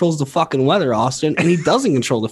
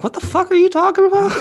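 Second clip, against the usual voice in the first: put-on baffled old man. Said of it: "He'll be like".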